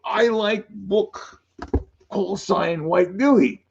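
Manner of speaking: slow, halting mock Chinese accent. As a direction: put on a clumsy Chinese accent, slowly. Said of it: "I'm trying to do my Chinese accent".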